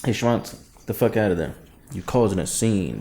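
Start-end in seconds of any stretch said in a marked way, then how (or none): none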